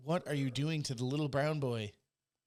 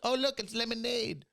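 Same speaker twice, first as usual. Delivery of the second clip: much higher, put-on voice; high-pitched comic quote; high mock exclamation; 9 semitones higher than usual. Acts out a joking exclamation.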